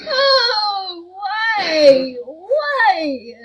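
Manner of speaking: high, loud wailing cry; anguished wail. Real, not acted. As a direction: cry out in despair at the game. Wailing after a setback in game.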